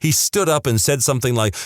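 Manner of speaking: ordinary delivery, nothing marked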